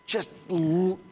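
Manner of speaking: ordinary delivery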